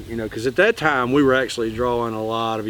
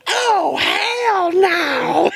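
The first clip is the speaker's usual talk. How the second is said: high-pitched mock shriek; high, hammed-up freak-out; retold panicked refusal; much slower than usual. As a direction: squeal it like you've just seen the fish's teeth.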